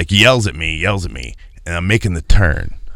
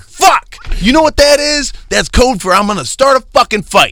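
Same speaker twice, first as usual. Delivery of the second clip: much higher, louder, fed-up yell of disbelief; exasperated outburst; 3 dB louder than usual. Retold or imitated. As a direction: explode at the obvious code words, hammed up. Retelling his outburst at his wife.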